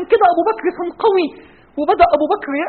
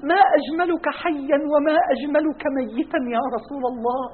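softer tender farewell; 7 dB quieter than usual. Acted. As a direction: near whispered goodbye to a beloved. أبو بكر يودع النبي. (وداع هادئ رقيق)

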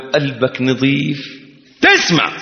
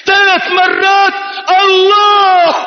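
impassioned sermon peak; preaching shout; slower than usual. Real, not acted. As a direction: cry it out at full force. Preacher climaxing.

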